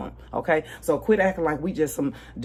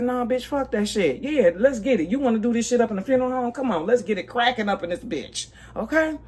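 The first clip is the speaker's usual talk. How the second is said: higher, pumped and ready to fight; hyped-up spirit; 7 semitones higher than usual. Retold or imitated. Her spirit urging her to fight at the funeral home, higher and hyped. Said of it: "And my spirit said".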